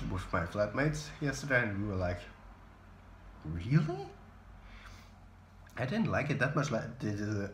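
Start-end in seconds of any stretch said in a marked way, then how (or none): 3.4-4.1 doubtful, rising question; underwhelmed, questioning "really?"; retold disbelief. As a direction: let the pitch climb in mock disbelief. Quoting their disappointed reaction to film.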